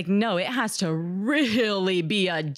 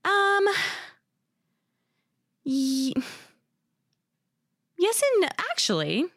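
hesitant thinking aloud; 10 semitones higher than usual. Real, not acted. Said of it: "I love the thought process that just went on there".

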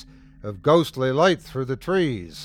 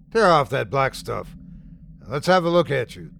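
commanding. A narrator's reading. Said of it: "commanded Kiefer".

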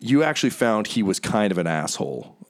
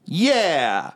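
high, self-satisfied show-off voice; preening bard; 10 semitones higher, much slower than usual. Acted. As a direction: announce yourself like a star. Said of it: "he stops and he goes".

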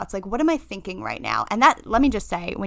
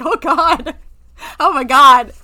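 loud, higher mock-horrified gasp of words; alarmed reaction; 6 semitones higher, 11 dB louder, slower than usual. Friend reacting to a whispered confession of feeling unwell, mock alarm. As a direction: overplay the alarm, louder and higher.

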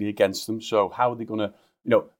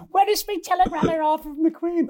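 put-on high, slow little old lady. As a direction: mimic an eager old woman waiting. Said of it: "little women around the country".

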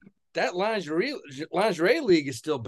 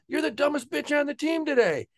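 mock coach insult; 9 semitones higher than usual. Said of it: "he goes".

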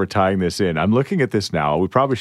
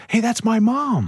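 a lightly voiced daughter, higher and slow; mock daughter voice; 13 semitones higher, slower than usual. Host retells the listener's daughter texting in, lightly voiced. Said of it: "and then her daughter texts and says".

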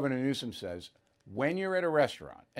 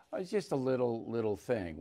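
lower, much softer mocking tone; mock dismissive; 5 semitones lower, 8 dB quieter than usual. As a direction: brush it off in a small mock voice. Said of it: "for Michelle Obama to say".

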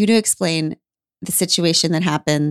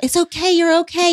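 much higher, soothing "It's okay"; reassuring; 10 semitones higher than usual. Retold or imitated. Mother imitates her daughter soothing embarrassed strangers, high and reassuring. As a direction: lift the voice high to calm strangers down. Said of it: "Then you're suddenly like".

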